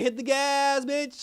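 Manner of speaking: loud, high-pitched comic command; mock urgent shout. Penguin to Vic once they're in trouble, mock shout. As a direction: bellow a hammed-up getaway order. Retold getaway order, for laughs.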